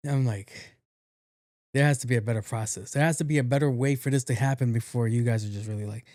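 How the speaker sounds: ordinary talk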